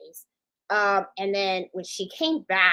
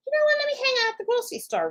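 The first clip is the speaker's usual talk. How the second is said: much higher and fast, gleeful plan; playful inner voice; much faster than usual. Retold or imitated. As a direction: chirp it like a giddy idea. His own thought voiced playfully.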